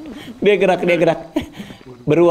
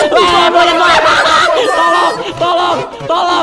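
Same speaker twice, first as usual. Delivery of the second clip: 1 panicked scream; 10 semitones higher, slower than usual. Real, not acted. Player attacked by monkey.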